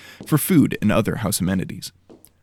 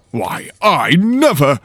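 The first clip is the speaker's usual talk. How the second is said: scandalised huff; 7 semitones higher, 8 dB louder, slower than usual. Acted. Lord scandalised.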